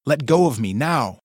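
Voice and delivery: shout. A narrator's reading. Wife fights him in dream.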